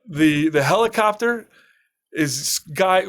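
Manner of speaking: ordinary talk, nothing marked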